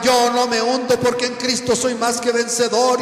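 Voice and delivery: loud, high triumphant declaration; mock battle-cry, high and loud; shouting. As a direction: shout it out like a boastful believer. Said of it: "empezó a gritar".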